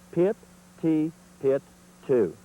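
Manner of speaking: "Pit, T, pit, two" punched syllable by syllable, loud; "Pit, T, pit, two" as four loud separate beats; exaggerated syllables. Shown as a demonstration. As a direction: spell out each syllable hard. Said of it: "I'm going to exaggerate it for you".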